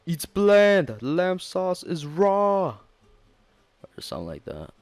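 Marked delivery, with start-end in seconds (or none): 0.1-2.8 loud, high shouting celebrity chef; Gordon Ramsay. Retold or imitated. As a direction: yell at the food like an angry chef. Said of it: "With Gordon Ramsay".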